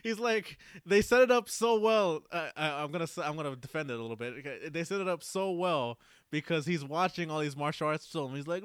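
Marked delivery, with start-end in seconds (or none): none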